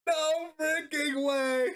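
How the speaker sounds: high, drawn-out, laughing amazement; delighted disbelief. Real, not acted. Streamer watches face damage land, delighted disbelief. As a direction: stretch it out high, breaking into laughter.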